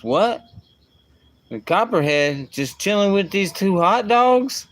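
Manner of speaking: lightly voiced little boy, amazed; imitating his young son. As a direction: retell the boy's delighted disbelief. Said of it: "he goes".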